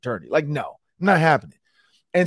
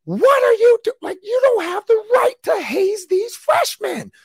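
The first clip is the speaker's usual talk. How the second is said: much higher, loud furious coach voice; coach yelling; 4 dB louder than usual. Retold or imitated. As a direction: play the coach tearing into a senior. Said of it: "looked him in the face and was like".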